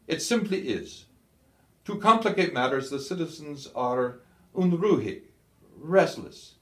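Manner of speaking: stern field marshal. A narrator's reading. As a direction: lecture the subordinate from above. Kesselring lectures Scholl.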